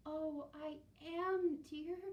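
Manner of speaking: put-on high, loud, slow old voice; a cold old lady trembling; shivering old woman.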